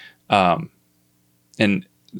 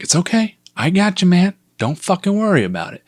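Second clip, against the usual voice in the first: high-pitched soothing of a scared pet; coaxing reassurance; 9 semitones higher than usual. Retold or imitated. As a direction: coo it gently up high, as if to a frightened bunny. Soothing a panicked bunny.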